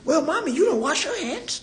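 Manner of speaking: put-on high, childish voice talking back to mommy; kid voice. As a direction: squeak it out like a little kid calling mom out. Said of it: "'Cause they like".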